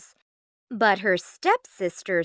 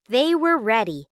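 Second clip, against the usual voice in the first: plain reading voice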